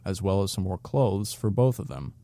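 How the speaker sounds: ordinary delivery, nothing marked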